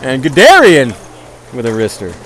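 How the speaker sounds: lively hockey commentary; play-by-play. Acted. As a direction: call the game as it happens.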